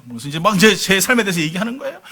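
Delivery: normal talking voice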